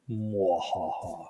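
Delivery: slow low evil cackle; sneering panto villain. Pretend. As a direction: gloat like a pantomime baddie. Host gloats after co-host drops off.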